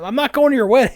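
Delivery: high, loud mock outrage; indignant refusal. Jilted man refusing to be best man, indignant outburst. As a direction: refuse as if deeply insulted. Retold angry refusal of invitation.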